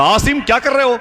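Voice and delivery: high, alarmed plea; wife crying out in alarm. Retold or imitated. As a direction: cry out to stop him. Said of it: "اس نے کہا". (بیوی کی گھبرائی ہوئی پکار)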